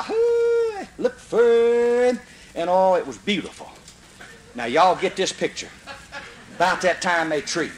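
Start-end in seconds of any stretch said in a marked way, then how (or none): 0.0-2.2 high drawn-out holler to the hounds; a brother hollering the hounds on; hollering at the dogs. Retold or imitated. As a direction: stretch it out slow and high. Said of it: "my brother Sonny holler".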